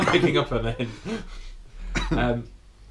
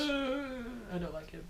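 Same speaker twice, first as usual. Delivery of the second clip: small scared voice; 10 semitones higher, 18 dB quieter, slower than usual. Real, not acted. Reacts to scary description.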